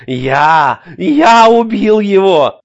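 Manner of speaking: high, slow frantic cry; hysterical shouting. A narrator's reading. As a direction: shriek it in shock and triumph. Larry still hysterical. (истерический крик)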